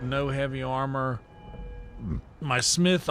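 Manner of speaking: normal talking voice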